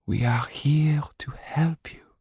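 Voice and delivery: slow, soft whisper; softly. Acted. Mrs Laxton whispers reassurance to Jan. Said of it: "said his wife softly".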